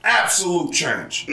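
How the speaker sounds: rousing mock stump speech to a crowd; rally the crowd like a candidate; campaign speech.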